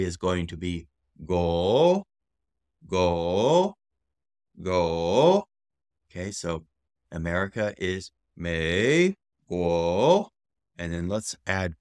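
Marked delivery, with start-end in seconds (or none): 1.3-5.4 long rising GO; tone demonstration. Shown as a demonstration. Teacher demonstrates tone of guo. 8.3-10.3 two long rising syllables, MEI GUO; tone demonstration. Shown as a demonstration. Teacher joins demonstrated syllables.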